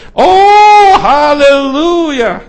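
huge high drawn-out cry of Halleluja; jubilant shout. Retold or imitated. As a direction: scream Halleluja like the heavens rejoice. Angels rejoice over convert. (lauter, hoher, gedehnter Jubelschrei)

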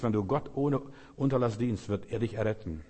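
ordinary talk, nothing marked

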